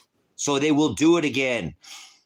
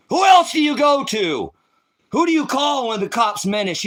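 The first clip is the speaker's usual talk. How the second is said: higher, louder, breaking out in despair; anguished cry; 9 semitones higher, 5 dB louder than usual. Real, not acted. Mother crying out in despair about who can help, loud. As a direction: cry out who is left to turn to. Desperate outcry at cops' involvement.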